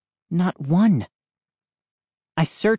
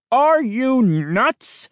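high, drawn-out outraged bellow; 9 semitones higher, 4 dB louder, much slower than usual. A narrator's reading. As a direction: bellow it in disbelief, stretching the words. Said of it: "he bellowed".

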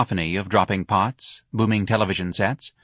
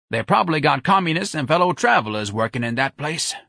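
put-on higher-pitched old worrier; suspicious Mr Robichaux; 7 semitones higher than usual. Robichaux suspects communists at the hospital, wary. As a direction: warn of a plot, in character.

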